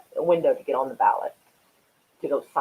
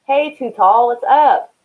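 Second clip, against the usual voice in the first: loud, higher hail to a friend; calling out a greeting; 7 semitones higher, 9 dB louder, slower than usual. Real, not acted. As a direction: call out a hearty hello, pitched up. Greets an arriving viewer by name.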